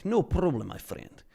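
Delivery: put-on Russian accent; friendly hammed-up Russian accent. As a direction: play a vodka-loving Russian pal with a thick accent. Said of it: "Let's do some Russian accent".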